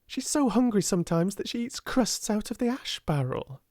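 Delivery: a hint of a hurt schoolgirl; girl defending her friend, lightly voiced; indignant girl. A narrator's reading. Sarah voiced by reader.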